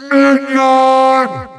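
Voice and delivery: high, drawn-out summons; commanding call. Acted. Villain calls a minion to punish meddling, commanding cry. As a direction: call out grandly for your henchman.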